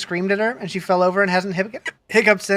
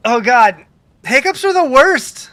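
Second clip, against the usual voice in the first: exaggerated exclaim; 3 semitones higher, 6 dB louder, slower than usual. Real, not acted. Exclaiming sympathy about hiccups.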